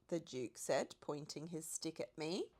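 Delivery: ordinary delivery, nothing marked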